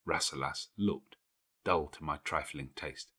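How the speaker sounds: ordinary delivery, nothing marked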